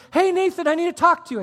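high, loud call to a neighbor. Retold or imitated. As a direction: call across the yard, urgently. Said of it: "he calls me and he says".

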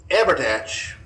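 ordinary talk

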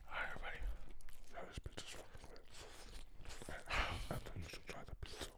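ASMR whisper. Pretend.

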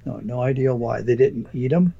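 usual speaking voice

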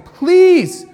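mock desperate servant begging his master; begging debtor. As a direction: plead for mercy on your knees. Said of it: "begged him".

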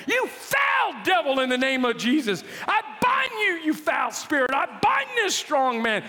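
a hammed-up exorcist's rebuke; casting out a devil. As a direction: rebuke the spirit with full force. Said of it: "I start casting the devil".

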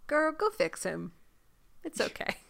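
soft, nearly whispered urging; hushed Regina. Retold or imitated. Regina urging Zelena to go, soft and hushed. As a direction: coax her gently under the breath. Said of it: "is like".

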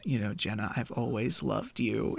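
low, soft hammed-up declaration; mock romantic murmur. Retelling the movie, Matt declares his love to Jenna, murmuring. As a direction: murmur it like a cheesy lover.

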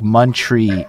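"Montreal" cut short to "mun-tree"; "Montreal" said slangily as "Montree"; slang pronunciation. Shown as a demonstration. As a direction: drop the final "al" of "Montreal", ending on "tree". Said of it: "like, some slang shit".

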